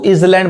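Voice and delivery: इसलैंड with the s sounded, "is-land"; wrong pronunciation. Shown as a demonstration. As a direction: start इसलैंड with a short "is". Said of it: "गलत पढ़".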